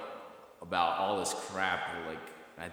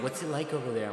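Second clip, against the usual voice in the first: higher, eager imitated questioner; curious locals; 7 semitones higher, 4 dB louder, faster than usual. Locals quizzing the fake Londoner, mock curiosity. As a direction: mimic an intrigued stranger asking. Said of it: "they're like".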